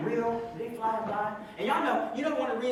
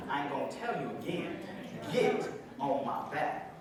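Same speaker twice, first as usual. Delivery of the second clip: stern final warning; 7 semitones lower, 8 dB quieter than usual. Acted. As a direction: warn them you won't ask twice.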